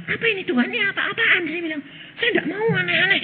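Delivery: high, half-laughing frightened cry; mock frightened plea. Preacher retelling his scared prayer to God, half-laughing. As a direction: beg God to stop the strangeness.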